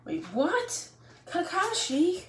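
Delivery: high, drawn-out dismayed cry; boy dismayed at the teacher's rule, high; shocked. Acted. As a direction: cry out the name in disbelief.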